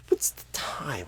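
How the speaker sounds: whispered flustered aside. Acted. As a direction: whisper the question to yourself. Flustered aside about the time.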